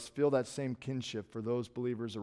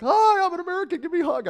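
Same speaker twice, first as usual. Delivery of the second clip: much higher, louder gushing greeting; overeager American; 6 dB louder than usual. Retold or imitated. Expat greeting a stranger from home, high, loud and gushing. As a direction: squeal it like a starstruck fellow American abroad. Meeting an American while living overseas.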